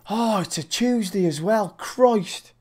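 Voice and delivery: high, hammed-up British moan; grumbling Brit. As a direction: imitate a Brit groaning at the day. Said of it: "we'll always do it".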